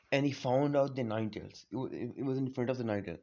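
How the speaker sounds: ordinary delivery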